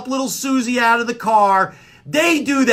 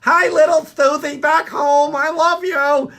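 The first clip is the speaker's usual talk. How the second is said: high, slow, hammed-up wave-at-the-camera voice; a goofy fan mugging for the camera; mock TV bystander; 8 semitones higher, 4 dB louder than usual. Mocking TV crowd greetings.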